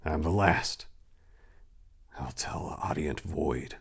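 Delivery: breathy whisper, low and hushed; dread-filled whisper. A narrator's reading. Last survivor confiding to the void in a whisper. Narrator's opening lines.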